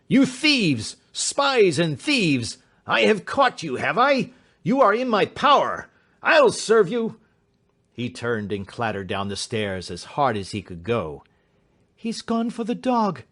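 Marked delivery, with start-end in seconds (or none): 0.1-7.2 raving shriek. A narrator's reading. Fat man threatens the detectives, raving high and loud. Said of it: "he shrieked". 11.9-13.2 frightened cry. A narrator's reading. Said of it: "cried Miss Hunter".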